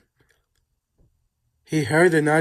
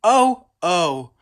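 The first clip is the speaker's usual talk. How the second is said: groan; 3 semitones higher, 5 dB louder than usual. A narrator's reading. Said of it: "James groaned".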